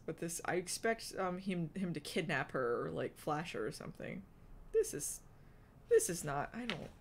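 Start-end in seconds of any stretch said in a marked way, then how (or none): none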